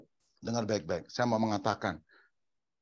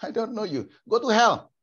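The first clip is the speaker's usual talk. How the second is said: higher, rising to a loud dismissal; stern acted rejection; dismissive God; 8 semitones higher, 3 dB louder than usual. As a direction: cast out a stranger, acted. Acts God rejecting a stranger.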